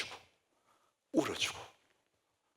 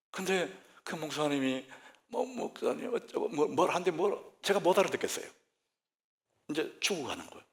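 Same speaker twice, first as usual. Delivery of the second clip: ordinary delivery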